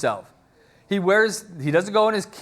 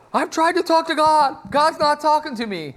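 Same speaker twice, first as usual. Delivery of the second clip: very high, louder protest; acted desperate plea; 11 semitones higher, 4 dB louder than usual. Saul telling Samuel God is silent, acted desperation. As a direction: plead your case, voice pitched way up.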